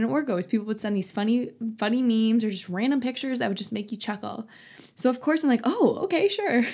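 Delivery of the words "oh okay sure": high, bright mock-eager "oh okay sure"; playful eager. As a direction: act eager for a funny meme. Excited reaction to airdrop.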